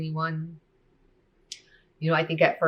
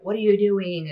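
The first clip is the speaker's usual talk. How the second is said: loud, higher retold yell; yelling cousin; 5 semitones higher, 7 dB louder than usual. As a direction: bark the question at the kids. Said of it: "she just starts yelling".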